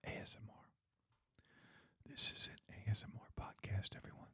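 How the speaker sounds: hushed, unvoiced podcast intro; creepy ASMR whisper. Teasing a co-host who hates mouth sounds, creepy ASMR whisper. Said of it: "Don't you dare start talking like that. So creepy".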